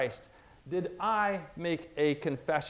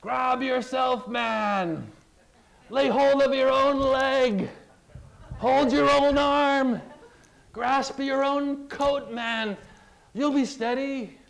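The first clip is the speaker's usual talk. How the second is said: shouted orders across a stormy deck, higher and louder; crying out; 7 semitones higher, 5 dB louder, slower than usual. Retold or imitated. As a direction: yell at a man sliding across the deck in a storm. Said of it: "someone cries out to you".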